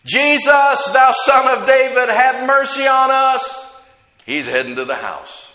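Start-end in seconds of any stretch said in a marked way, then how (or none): 0.0-3.7 loud, high, sustained plea; crying out. Acted. Blind men calling after a departing Jesus, crying out louder. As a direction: holler it after someone walking away. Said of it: "two blind guys crying out".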